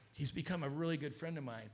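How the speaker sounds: ordinary delivery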